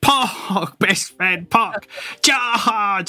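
rally your friends into the charge; rallying cry. Pretend. Player rallies party to charge.

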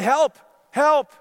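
high, loud calls for help; first cries for help. Acted. Jack hanging from a branch, calling loudly for help. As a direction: call out for help. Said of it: "And Jack said".

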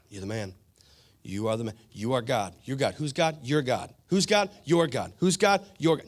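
mirror pep talk. Pretend. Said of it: "looking in the mirror at the beginning of a tough day and be like".

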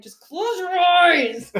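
put-on high, slow, drawn-out yell at the kids; exasperated yell. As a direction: stretch out "Just close your eyes!" like a fed-up mom. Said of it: "It was better than me saying".

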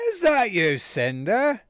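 high, loud swooping British call; hammed-up British recognition; British accent. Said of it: "now it's back to, I keep crossing between".

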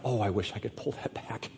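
soft, regretful sigh of a line; wistful hush. Pretend. Sermon example of regret, softly wistful. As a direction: murmur it like instant regret.

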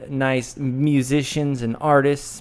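normal talking voice

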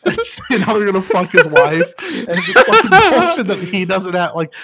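loud, high, rowdy heckling; mock trash-talk. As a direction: yell insults like a rowdy crowd. Voicing characters trash-talking McMurray.

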